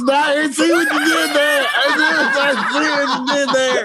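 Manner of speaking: high laughing shout. Real, not acted. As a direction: squeal it through laughter. Laughing at the pun.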